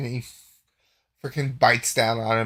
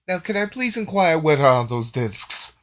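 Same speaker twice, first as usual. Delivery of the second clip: high, loud plea sliding downward; put-on prying voice, pitched up; wheedling character; 6 semitones higher, 8 dB louder than usual. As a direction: ask with exaggerated, insistent politeness. Game master voices a wheedling inquiry.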